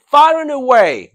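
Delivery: FAR AND AWAY high and loud; emphatic stress. Shown as a demonstration. Teacher repeating the stronger phrase for students, very emphatically. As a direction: punch "Far and away" hard from the top. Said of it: "more emphasis".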